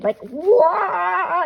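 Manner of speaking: high playful wah, like a cartoon zoom; super speed whoosh. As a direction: make the sound of rushing past at super speed. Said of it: "Oh, super speed".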